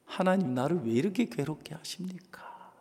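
breathy whisper, no voice at all; whispered complaint. Retold or imitated. Moses whispers his complaint to God, hushed. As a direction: whisper Moses' plea as if ashamed to say it aloud.

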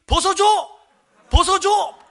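high, punchy crowd-style call; egging on. Retold or imitated. Nearby pastors goading a colleague, high teasing shouts.

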